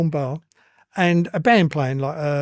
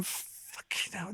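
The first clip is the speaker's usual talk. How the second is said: stunned whisper; 19 dB quieter, much slower than usual. Retold or imitated. Awed reaction entering naked nightclub.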